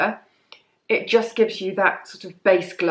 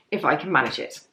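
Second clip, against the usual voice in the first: croaky voice; 4 semitones lower, faster than usual. Real, not acted. Vlogger wrapping up her aside on diary posts, voice going croaky. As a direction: voice cracking low into a croak. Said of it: "my voice went very croaky then".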